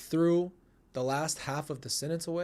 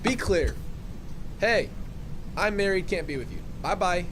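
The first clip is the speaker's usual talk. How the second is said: mock example text; 5 semitones higher, 3 dB louder than usual. Acting what she should say.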